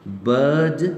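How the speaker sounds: बर्ड with a long schwa and silent r; British "bird", no r before d; British non-rhotic pronunciation. Shown as a demonstration. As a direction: say बर्ड drawn out, skipping the r. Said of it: "तो हम आ को नहीं पढ़ेंगे".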